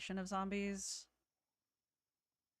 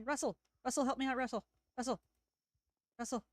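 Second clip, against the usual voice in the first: high, louder panicked call for help; breathless fright; 7 semitones higher, 4 dB louder, faster than usual. Real, not acted. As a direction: shout for Russell, scared. Calling companion for help while chased.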